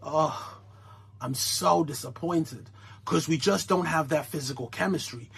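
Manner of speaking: mock sad wife, soft and low; mock disappointed.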